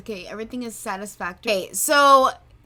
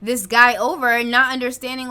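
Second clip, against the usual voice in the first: usual speaking voice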